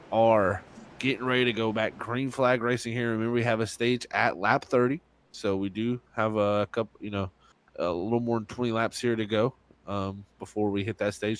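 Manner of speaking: ordinary talk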